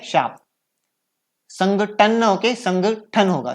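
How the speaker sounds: normal talking voice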